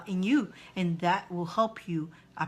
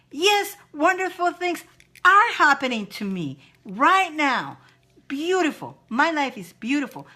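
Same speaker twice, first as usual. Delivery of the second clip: excited affirmation; 6 semitones higher, 5 dB louder than usual. Shown as a demonstration. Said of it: "change that mind into".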